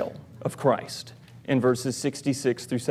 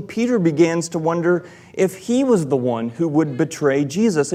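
ordinary talk

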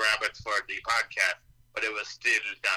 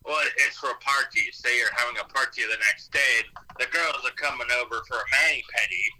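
ordinary delivery

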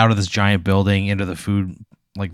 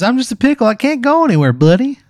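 very high, loud and chirpy; a cheerful little pickle introducing himself; squeaky cartoon pickle; 5 dB louder than usual. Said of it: "He goes".

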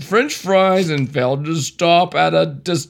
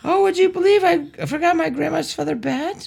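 high nervous driver voice; 12 semitones higher than usual. Acted. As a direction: squeak it out like a flustered driver. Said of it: "Do different voices".

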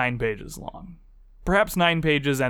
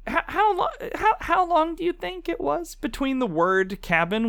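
exasperated; 13 semitones higher than usual. Real, not acted. Exasperated rant at listeners.

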